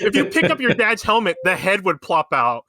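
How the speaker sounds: laughing through the words; amused, chuckling while talking. Real, not acted. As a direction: break into a laugh as you say it. Joking about dad's helmet.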